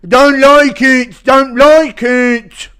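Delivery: high, loud, slow stubborn grumble; Menifield impression. Man refusing police orders, slow and loud. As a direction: drag it out, refusing to budge. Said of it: "Impression of Alonso Menifield".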